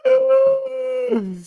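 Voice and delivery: very high squealing laugh; helpless laughter. Real, not acted. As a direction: lose it completely in a long squeal. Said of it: "I lost my shit".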